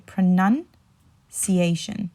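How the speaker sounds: pronunciation broken up slowly, NUN rising, then a pause before ciation; pro-NUN... ciation, pronunciation taught in slow pieces; syllable-by-syllable demo. Shown as a demonstration. Teacher reminds of the nun inside, pronunciation slowly split after NUN. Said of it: "remember there's a nun in the middle".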